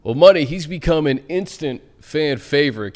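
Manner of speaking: usual speaking voice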